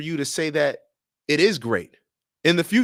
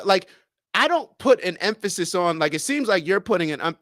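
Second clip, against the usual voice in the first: normal talking voice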